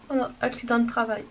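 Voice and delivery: usual speaking voice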